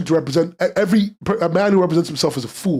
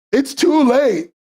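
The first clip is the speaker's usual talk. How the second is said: mock despair; 10 semitones higher, much slower than usual. Real, not acted. Lament over missed nominations.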